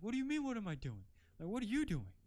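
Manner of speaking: high, loud and fast mock yell; indignant shout. Narrator yelling back at the stranger, indignant fast shout. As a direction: throw the question back, outraged.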